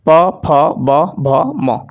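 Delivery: प, फ, ब, भ, म drawn out very slowly; teacher sounds out प, फ, ब, भ, म very slowly; slow recital of the letters. Shown as a demonstration. Teacher slowly repeats प, फ, ब, भ, म for students to copy. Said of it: "आप लोग उच्चारण करिए".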